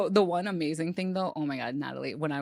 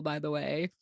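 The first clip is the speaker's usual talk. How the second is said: ordinary talk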